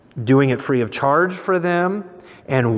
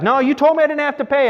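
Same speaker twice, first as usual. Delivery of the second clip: high, rushed retold objection; indignant protest; 12 semitones higher, faster than usual. Tourist refusing to pay the artist, indignant protest. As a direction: argue back fast, pitched well up.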